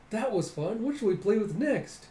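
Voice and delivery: high, lilting playful voice; playful prankster. Retold or imitated. Pranksters looking for the next game, high and playful.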